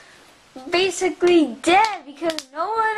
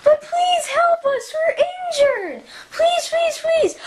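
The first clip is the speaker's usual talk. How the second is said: higher, urgent begging; pleading calls; 9 semitones higher than usual. Pretend. Injured toy begging Commander Rex, higher urgent pleading. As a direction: beg him not to leave.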